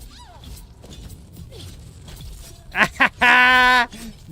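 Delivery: loud, high burst of laughter; gloating cackle. Real, not acted. Player beat a rival to it, gloating laughter. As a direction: cackle loudly at the loser.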